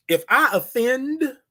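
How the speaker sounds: high, drawn-out "offend" held in suspense; "offend" held long and high, left hanging; emphatic speaking style. Shown as a demonstration. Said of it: "you gotta talk like that".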